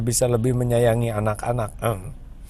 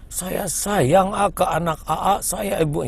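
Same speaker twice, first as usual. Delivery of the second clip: a high, lightly voiced loving mother; mother saying she loves her child; 7 semitones higher than usual. Preacher voicing a mother telling her child she loves them, lightly high. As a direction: coo it like a doting mother.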